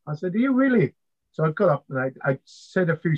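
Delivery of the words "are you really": high, sceptical retold question; mock disbelief. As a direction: send the pitch soaring in doubt. Doubts the self-declared expert.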